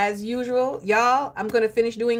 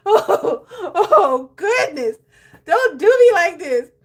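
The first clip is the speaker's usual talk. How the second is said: high, loud laughing protest; real overcome laughter; laughing exasperation; 9 semitones higher, 8 dB louder, slower than usual. Real, not acted. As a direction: beg for mercy while laughing. Overwhelmed by laughter.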